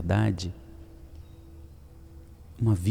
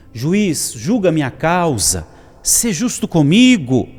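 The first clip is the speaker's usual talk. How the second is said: loud, high, drawn-out plea; insistent widow; 8 semitones higher, 7 dB louder, slower than usual. Acted. Persistent widow pleads with the judge, crying out. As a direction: call out slowly, demanding to be heard. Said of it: "É igual a viúva".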